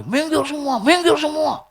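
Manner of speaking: mock distraught yell. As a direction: imitate a frantic mourner clearing everyone away. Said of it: "teriak dia". (teriak histeris pura-pura, nada tinggi)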